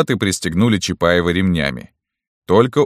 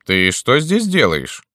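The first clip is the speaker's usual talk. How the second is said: high, slow colonel's question; slow, lofty colonel; 8 semitones higher than usual. A narrator's reading. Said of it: "спросил полковник".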